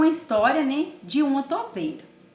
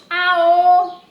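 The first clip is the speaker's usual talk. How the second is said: high, loud yell; shout; 5 semitones higher, 8 dB louder than usual. Acted. Mole yelling back at grandma. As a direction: belt the cry.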